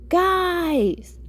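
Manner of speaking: high exasperated yell, drawn out; big high-pitched call of annoyance. A narrator's reading. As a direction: yell it out to get their attention. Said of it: "I yelled".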